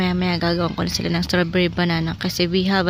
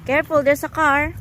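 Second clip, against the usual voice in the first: high, louder warning shout; warning call; 7 semitones higher, 7 dB louder than usual. Real, not acted. Mother calling to her child about a car, loud warning.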